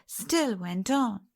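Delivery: ordinary delivery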